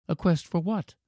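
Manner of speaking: put-on high, soft child's question; eager boy. A narrator's reading. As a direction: ask as a curious little boy. Boy eager to hear secret.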